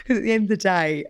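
laughing through the words. Real, not acted. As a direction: giggle while talking fast. Laughing after teasing.

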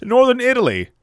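high, proud announcement of Northern Italy; hammed-up grand proclamation; mock proclamation. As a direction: declare it like a proud patriot.

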